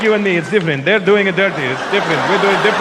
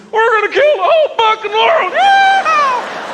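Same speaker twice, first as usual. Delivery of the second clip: high, yelled mock fanatic battle cry; slower than usual. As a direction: scream it like a wild-eyed extremist. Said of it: "they'd sound like this".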